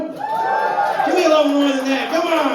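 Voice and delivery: high, loud drawn-out MC shout; big, high-pitched holler to the room; hyping up the crowd. Real, not acted. MC calls for more crowd noise.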